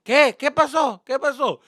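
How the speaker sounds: lightly voiced alarmed mom; high, loud questioning as the mother; startled mother's reply. Mother answering her yelling son.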